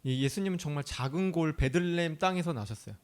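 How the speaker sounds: normal talking voice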